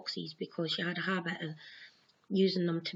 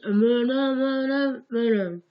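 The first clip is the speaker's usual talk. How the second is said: slow, louder stuck nonsense syllables; garbled gobbledygook; 4 semitones higher, 8 dB louder, slower than usual. Retold or imitated. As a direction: drag out the nonsense as if tongue-tied.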